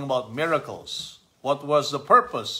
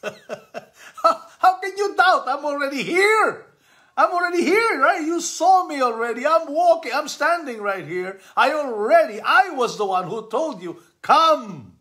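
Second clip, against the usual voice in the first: much higher, laughing and teasing; laughing Jesus; 14 semitones higher, 5 dB louder than usual. Acted. Jesus teases doubting Peter, laughing and high. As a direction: chuckle as you scold a friend.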